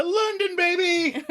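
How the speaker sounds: very high whooped announcement; excited catchphrase. Retold or imitated. Recapper cuts the scene to London, excited. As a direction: cheer it in a silly high voice.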